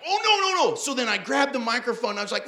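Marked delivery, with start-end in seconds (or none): 0.1-0.7 high, panicked no no no; mock panic, much higher; in shock. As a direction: realize the blunder in horror. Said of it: "And in shock, I was like".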